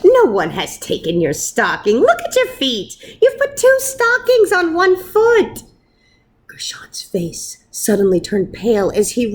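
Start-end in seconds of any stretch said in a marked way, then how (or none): 0.0-5.6 high, laughing wife's voice; wife amused at his mistake. A narrator's reading. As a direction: tease him gently, much higher. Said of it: "Fega laughed".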